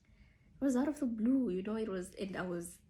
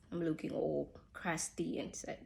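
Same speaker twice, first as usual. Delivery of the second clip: usual speaking voice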